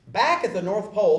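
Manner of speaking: usual speaking voice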